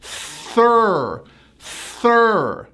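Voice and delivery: Thir as a long TH sliding into R; thir, drawn out and falling; TH plus R. Shown as a demonstration. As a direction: stretch thir on a held R. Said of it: "now add an R".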